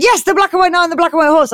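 high, fast emphatic confirmation; hammed-up proud yes, rattled off; excited knight. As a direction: fire it back with mock triumph. Knight matches goblin's excitement.

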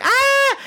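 very high-pitched short shriek; hammed-up squeal of fright; mock scream. [name] grabbed by the claw hand, mock scream.

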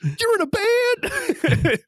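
very high, slow incredulous squeak; baffled disbelief. Retold or imitated. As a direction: stretch it out, utterly confused.

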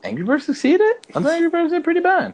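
high, loud disbelief; can't believe what he just heard; incredulous. Real, not acted. Disbelief at Angry Birds pick.

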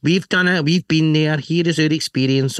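high, proud big brother passing on wisdom; fatherly advice voice. Pretend. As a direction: talk down to the little one like a proud dad. Bigger bike voicing advice to baby.

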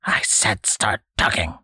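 "I said start talking" hissed in an unvoiced whisper, START pushed. Old man repeats his demand, hissing. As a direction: breathe "I said start talking" as a menacing hiss. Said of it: "the old man hissed".

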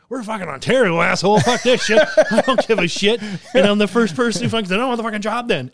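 indignant outburst. Retold or imitated. Worker telling off the boss over French, loud and indignant. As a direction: retell it as a heated blow-up at the boss.

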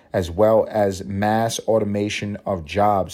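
ordinary talk, nothing marked

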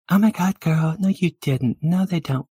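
put-on high, loud girly disbelief; high-pitched, loud "oh my God, girl" voice; gushing valley girl, scandalized.

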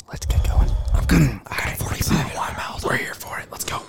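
leaning in to whisper. Shown as a demonstration. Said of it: "in a whisper voice".

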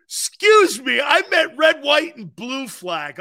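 loud, high, slowing on the colours; mock correction shout. Shown as a demonstration. Host loudly correcting his rainbow slip as a joke. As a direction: shout the correction like a public retraction. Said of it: "I misspoke".

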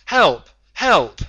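screamed high and loud for help; desperate yell. Acted. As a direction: scream it like a man dragged off by a tentacle. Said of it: "he yelled".

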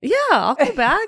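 high, laughing agreement. Real, not acted. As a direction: laugh your yes. Laughing reply to invitation.

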